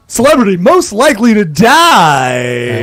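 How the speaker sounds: booming slow announcer call; fighting game announcer. Pretend. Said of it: "can I do my announcer voice?".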